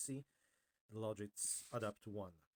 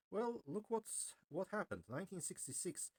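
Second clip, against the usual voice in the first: very high pitch; 7 semitones higher than usual. Real, not acted. Revealing the corrected result.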